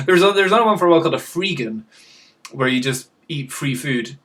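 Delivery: normal talking voice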